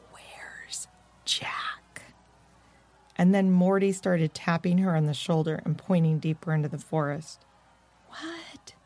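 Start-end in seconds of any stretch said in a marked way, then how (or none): 0.1-2.0 slow, voiceless whisper; mouthed words. A narrator's reading. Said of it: "and mouthed the words". 8.1-8.7 tiny, very soft, high whisper. A narrator's reading. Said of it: "whispered Annalise".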